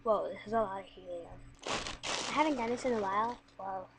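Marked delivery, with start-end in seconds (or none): none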